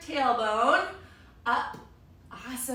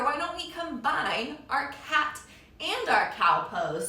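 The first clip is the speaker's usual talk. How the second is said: normal talking voice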